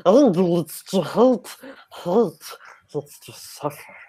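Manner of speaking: high rasping whispered Gollum; Gollum doing Yoda. Retold or imitated. Co-host does Gollum in Star Wars on request. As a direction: hiss it like Gollum preaching Jedi wisdom. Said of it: "do Gollum in Star Wars".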